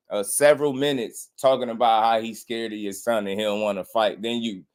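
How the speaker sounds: ordinary talk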